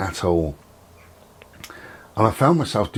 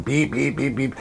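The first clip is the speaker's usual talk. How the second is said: higher mock alarm beeps; imitating alarm beeps; 7 semitones higher than usual. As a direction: chirp the beeps like an annoying alarm. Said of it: "rather than".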